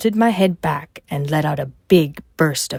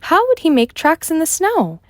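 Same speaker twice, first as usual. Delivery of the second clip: put-on puzzled girl; 13 semitones higher, 4 dB louder than usual. Mulan interrupts, puzzled.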